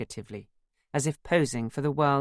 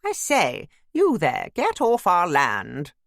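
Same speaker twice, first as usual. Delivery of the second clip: loud, hammed-up cut-glass English call; cut-glass accent; 4 semitones higher, 7 dB louder, slower than usual. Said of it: "calling out in my best cut-glass accent".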